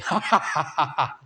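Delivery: high, hammed-up laughing; falsetto cackle. A narrator's reading. Safari Man laughs at his marriage. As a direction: cackle at your own joke.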